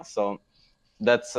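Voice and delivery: normal talking voice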